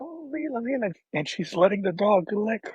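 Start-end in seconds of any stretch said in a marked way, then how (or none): 0.0-0.9 high, softly imitated little girl's glee; a gentle, softer imitation of a happy toddler; delighted child. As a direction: lift up high like a small child thrilled to see her dog. Retelling his daughter greeting the dog.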